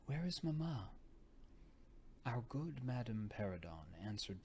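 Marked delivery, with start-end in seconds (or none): 0.0-0.9 put-on a light, plaintive young lady; sweet complaining voice. A narrator's reading. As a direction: ask for her mother sweetly, a little complaining. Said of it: "a very sweet voice ask complainingly".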